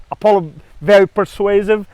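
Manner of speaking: ordinary talk, nothing marked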